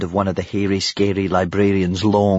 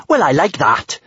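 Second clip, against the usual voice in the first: higher, indignant retort; indignant exclamation; 6 semitones higher, 4 dB louder than usual. A narrator's reading. Kamikaze answers back to her mother, indignant. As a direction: exclaim it with affront. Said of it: "she exclaimed".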